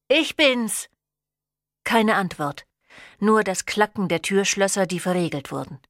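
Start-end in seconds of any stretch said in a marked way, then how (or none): 0.1-0.9 calling out. A narrator's reading. Locked out, calling mother.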